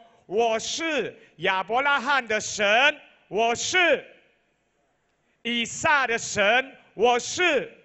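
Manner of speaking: loud, ringing voice of God; loud proclaiming. Acted. Preacher voicing God to the church, loud and ringing. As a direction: declare each name like thunder. God's words, proclaimed from the pulpit.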